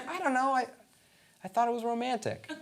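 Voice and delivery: high, lightly imitated girlfriend voice; sheepish girlfriend. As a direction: offer a lightly mimicked sheepish excuse. Acts girlfriend's sheepish excuse.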